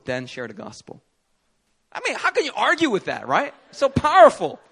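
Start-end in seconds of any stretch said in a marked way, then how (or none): 1.9-4.6 high, loud and thrilled; rising enthusiasm about the healings; excited. Real, not acted. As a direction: sell how powerful it felt.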